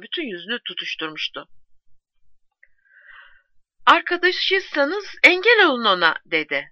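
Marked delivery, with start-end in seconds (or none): 3.9-6.1 high, loud, urgent appeal; urgent plea. A narrator's reading. Postwoman begs the soldier's friends to stop him, urgent plea. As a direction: plead for someone to step in.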